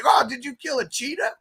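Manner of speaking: high, amused character voice; high mock quote. Retelling Road Trip scene to cohost, high teasing voice. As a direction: tease him about the underwear.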